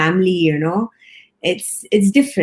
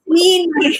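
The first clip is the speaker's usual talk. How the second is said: words breaking into a high giggle; laughing through the words, pitch jumping high; 9 semitones higher than usual. Real, not acted. Bursts out laughing recalling crew.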